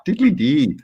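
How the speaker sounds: hammy high Irish lilt; mock Irish singsong accent; bad Irish accent. Shown as a demonstration. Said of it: "I'm doing a really bad Irish accent".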